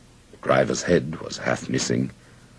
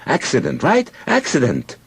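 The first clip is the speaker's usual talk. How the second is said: high, urgent demand for agreement; shouted; 13 semitones higher, 4 dB louder than usual. A narrator's reading. Soldier grabbing a witness by the collar, shouted. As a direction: press it on the listener, voice high. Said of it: "shouted at me in Hebrew".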